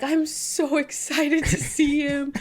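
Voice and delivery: high-pitched mock-crying gush; imitated tearful gushing. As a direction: imitate someone weepily overjoyed about a pet. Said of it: "you started crying again".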